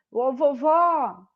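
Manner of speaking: drawn-out call to grandma, stressed last syllable. Shown as a demonstration. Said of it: "vou chamar a vovó como se fosse minha amiga".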